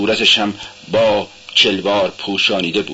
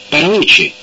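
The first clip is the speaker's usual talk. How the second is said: high, louder startled why; surprised question; 8 semitones higher, 4 dB louder than usual. A narrator's reading. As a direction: jump up in surprise on it. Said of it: "سونیا با تعجب پرسید". (با تعجب و صدای بلندتر بپرس)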